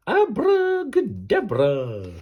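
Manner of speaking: high, slow, loud witch's incantation; stretched-out magic cry; big spell shout. Acted. Final spell cast.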